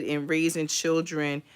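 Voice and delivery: ordinary talk, nothing marked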